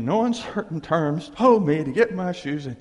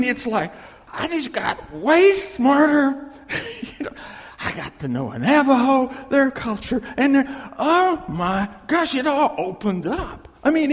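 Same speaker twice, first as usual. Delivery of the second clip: higher, louder rush of delight; real excitement; 8 semitones higher, 5 dB louder than usual. Real, not acted. Preacher telling congregation his breakthrough with wife, excited and louder. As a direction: burst out with the thrill of understanding.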